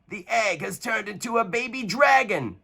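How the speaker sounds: high, amazed knight's exclamation; astonished cry. A narrator's reading. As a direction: realise the egg was a dragon. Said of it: "cried Uniqua the knight".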